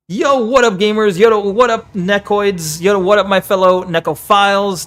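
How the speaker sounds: high, loud hyped YouTuber intro; YouTube voice. Pretend. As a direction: greet the viewers like a hyped YouTuber. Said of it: "my YouTube voice".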